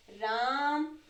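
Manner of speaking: usual speaking voice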